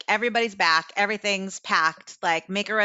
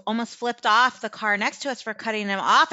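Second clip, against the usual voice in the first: ordinary talk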